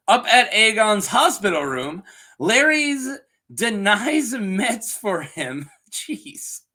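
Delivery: ordinary delivery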